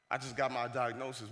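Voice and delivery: low, soft admission; grave confession. Retold or imitated. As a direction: imitate a teacher breaking bad news. Teacher reveals illness to student.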